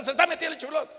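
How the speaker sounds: wife scolding about the draft; angry yell. Acted. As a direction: scold with annoyance. Wife yelling continues.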